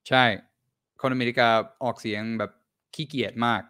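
usual speaking voice

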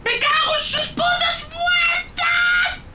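very high, drawn-out screamed curse; terrified scream. Real, not acted. Player hit by a jump scare, screamed curse. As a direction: scream the swearing at full pitch.

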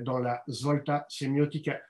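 «la Svolta Semiotica» dit à l'italienne, avec un léger accent italien. Real, not acted. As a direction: prononcer «Svolta Semiotica» en italien, sans appuyer. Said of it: "désolé pour mon accent italien". (titre italien dit avec un accent italien discret)

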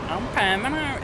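high mock Brummie accent; exaggerated Birmingham impression. Shown as a demonstration. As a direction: put on a high-pitched Brummie whine. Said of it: "I swear it's like that".

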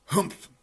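high, forceful "humph"; loud grunt. A narrator's reading. Bear unimpressed by rabbit's ears, loud grunt. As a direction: grunt it out in one burst. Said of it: "the bear grunted".

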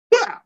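short, sudden exclaimed impact noise; imitated tackle impact. Player acting out his hit on the fullback, imitated impact. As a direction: punch out the sound of a hit.

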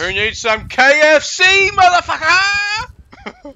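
high, drawn-out goofy yell; loud silly shout. Pretend. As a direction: belt it out like a joke. Shouting a silly KFC joke.

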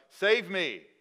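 loud, high cry of "Save me". Retold or imitated. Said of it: "He cries out to God".

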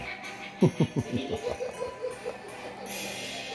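shrill breathy villain cackle; evil cackle. Acted. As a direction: cackle wickedly.

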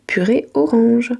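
plain reading voice

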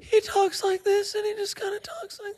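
very high, soft, eerie voice; creepy serial killer voice. Shown as a demonstration. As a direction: imitate a killer's unsettling high little voice. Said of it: "fucking scary 'cause he just".

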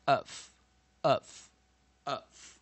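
"of" as a short "uh" closed by F; schwa plus F. Shown as a demonstration. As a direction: sound out "uhf" in separate, punched repeats. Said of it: "标示为呃， 那F照抄". (短促有力地拼读“呃”加F)

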